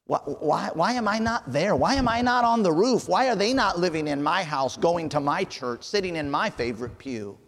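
high, loud, pleading cry to God; building desperate prayer, peaking on the last why; anguished plea. Pretend. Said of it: "when we realize that".

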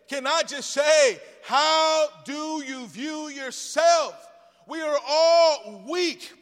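loud, drawn-out preaching that climbs in pitch; full-force preaching. Real, not acted. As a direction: build from slow scripture to a high, shouted plea. Preaches scripture and hymn words.

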